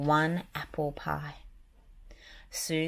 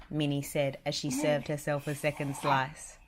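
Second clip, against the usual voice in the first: ordinary delivery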